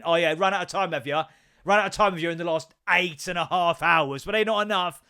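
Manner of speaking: sarcastic dad scolding the son. Retold or imitated. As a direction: throw his excuse back at him. Mocking son's excuse, sarcastic.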